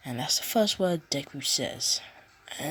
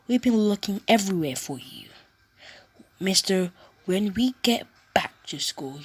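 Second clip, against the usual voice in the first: louder, fast scolding; 4 semitones higher, 5 dB louder than usual. Acted. Classmates scolding Deku for disappearing. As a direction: tell him off for getting lost.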